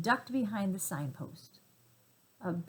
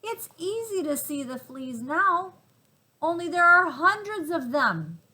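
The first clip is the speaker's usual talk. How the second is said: excited little monkeys; 8 semitones higher, 7 dB louder than usual. Acted.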